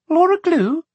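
put-on high, hesitant girl's question. A narrator's reading. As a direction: ask uncertainly in a child's high voice.

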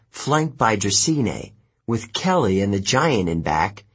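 ordinary delivery

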